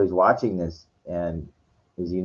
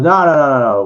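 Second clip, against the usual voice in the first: loud, high-pitched string of objections; acted alarmed refusal; 7 semitones higher, 6 dB louder than usual. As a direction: cut him off in mock alarm. Retold Kirk refuses Sybok's offer.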